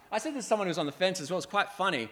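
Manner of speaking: ordinary talk, nothing marked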